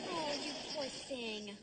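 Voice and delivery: slow, soft mock pity; mock sympathy. Working friend answering a rich girl's complaint, mock sympathy. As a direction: draw out the pity, softly and insincerely.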